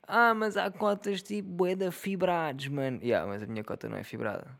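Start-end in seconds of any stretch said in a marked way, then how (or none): 0.1-3.0 high, slow dopey mock voice; mock commenter. Mocking a viewer who says some mums are tough, slow dopey voice. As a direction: put on a slow, dim voice for the know-it-all.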